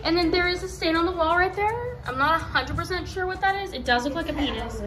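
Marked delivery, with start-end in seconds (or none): none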